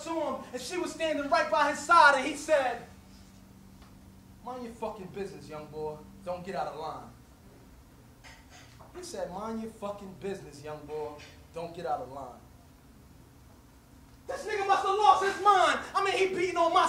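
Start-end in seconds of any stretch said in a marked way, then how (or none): 4.4-7.1 low threatening street tough; low menacing threat. Retold or imitated. Man threatening the teen. 9.3-12.4 deep, quiet menacing voice; a quieter, lower echo of the threat. Retold or imitated. Repeated threat from older man.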